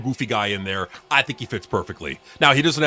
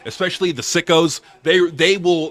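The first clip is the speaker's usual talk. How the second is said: ordinary delivery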